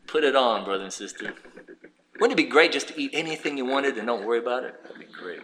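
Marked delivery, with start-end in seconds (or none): none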